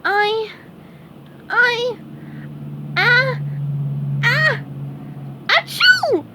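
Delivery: high squeaky stammer building to a sneeze. Acted. SpongeBob sneezes at Patrick, squeaky building sneeze. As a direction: wind up slowly and explode into achoo. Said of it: "SpongeBob sneezed".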